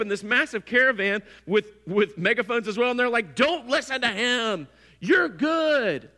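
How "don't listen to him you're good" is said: higher, louder, slow soothing call; higher and louder false comfort, drawn out; false reassurance. Retold or imitated. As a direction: reassure the crowd through a megaphone. Rival megaphone caravan reassuring.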